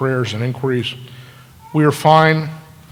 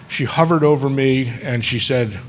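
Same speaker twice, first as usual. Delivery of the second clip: normal talking voice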